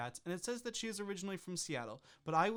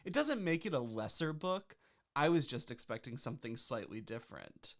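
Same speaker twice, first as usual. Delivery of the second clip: ordinary delivery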